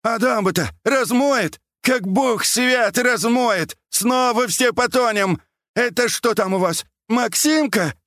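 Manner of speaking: put-on high, alarmed old man's cries; high-pitched panicky hollering; shouting. A narrator's reading. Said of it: "Потом крикнул".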